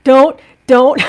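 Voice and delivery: loud frantic warning to the puppy. Retold or imitated. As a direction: stop the puppy eating nails, fast and urgent. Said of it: "I was just like".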